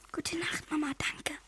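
soft secret whisper. Acted. Heimlich vor Papa. (leises Flüstern)

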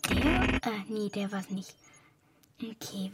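hushed, puzzled whisper. Real, not acted. [name] alone at the switchboard, whispering to herself. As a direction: whisper to yourself while searching.

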